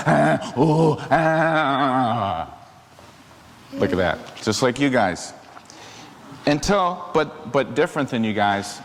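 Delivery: ordinary delivery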